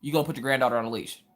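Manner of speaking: ordinary talk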